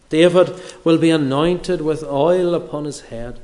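ordinary delivery

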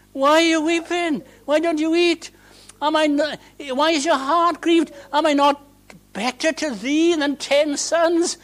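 high, earnest husband pleading; a hint of a worried husband; Elkanah pleading. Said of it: "poor old Elkanah".

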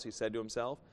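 normal talking voice

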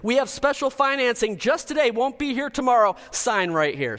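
loud, high fast-talking car salesman; snake oil seller. Pretend. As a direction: pitch the car like a huckster. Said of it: "You want me to show you how I can sell cars?".

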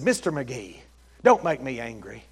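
low, quiet warning; David Banner. Retold or imitated. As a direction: imitate a soft-spoken TV warning. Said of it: "there was David Banner".

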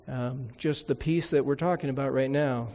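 usual speaking voice

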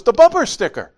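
high, loud reminder; urgent daughter. Retold or imitated. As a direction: hiss the warning to dad. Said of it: "She goes".

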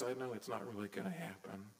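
soft, quick aside to oneself; quiet inner doubt. Retold or imitated. Believer doubting God in their heart, soft quick murmur. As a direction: murmur the secret doubt under your breath, quickly. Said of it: "we say in our little hearts afterwards".

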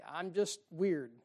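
normal talking voice

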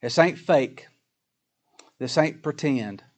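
ordinary talk

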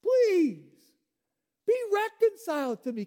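high, loud pleading cry; a father crying out for his child to come home; desperate begging. Acted. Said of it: "as if God himself were begging you".

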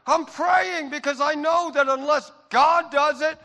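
loud, high-pitched pulpit shout; impassioned high, loud sermon delivery; full-force preaching. Real, not acted. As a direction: preach it at the top of your voice, punching key words.

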